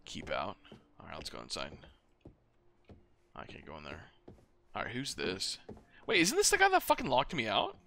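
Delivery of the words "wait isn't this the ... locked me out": higher, louder outraged disbelief; indignant surprise. Real, not acted. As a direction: call out the betrayal. Recognises the guy who locked him out.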